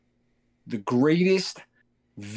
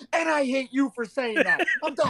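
laughing mock outrage; 11 semitones higher than usual. Mock reply to wife.

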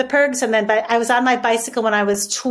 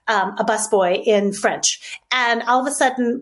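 ordinary talk, nothing marked

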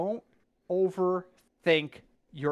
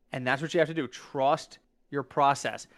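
ordinary talk, nothing marked

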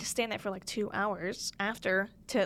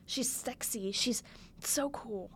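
soft, slow whisper on "so cool"; awed whisper; 6 dB quieter, slower than usual. Real, not acted. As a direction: hush it out like a secret crush. Repeats praise, whispered then aloud.

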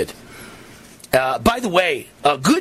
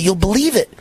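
ordinary talk

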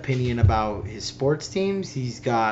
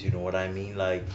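low, quick, soft-spoken Miami guy; Miami guy impression; 6 semitones lower, 6 dB quieter, faster than usual. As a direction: talk low and fast like a local Miami guy. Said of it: "He kinda talks like this".

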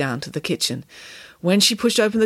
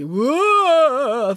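high, loud ghostly "whoa"; long swooping spook wail; 7 semitones higher, 5 dB louder than usual. A narrator's reading. Derek playing a ghost for good measure, ghostly wail. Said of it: "throwing on a ghostly".

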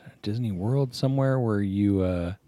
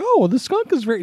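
high, fast, laughing fake consolation; laughing mock pity; 7 dB louder, faster than usual. A voice consoling a kid who got skunk, laughing. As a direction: stammer a consolation through laughter. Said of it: "And it's like".

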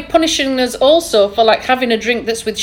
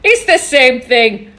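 laughing; 3 semitones higher, slower than usual. Real, not acted. Laughing after keto demo.

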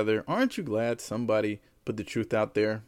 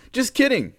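high jokey; 7 dB louder than usual. Real, not acted. Joke reversal.

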